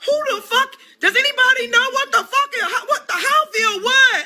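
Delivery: rising to a high incredulous yell with a laugh; retorting on the phone to the man; mocking outrage. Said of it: "I said".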